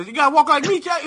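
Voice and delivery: high, swaggering street-tough voice; a cocky thug telling the robot how to walk; Chappie gangster voice. Retold or imitated. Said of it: "those two motherfuckers from Chappie".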